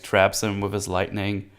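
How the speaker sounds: ordinary talk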